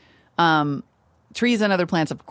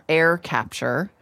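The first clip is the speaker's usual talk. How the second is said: ordinary talk, nothing marked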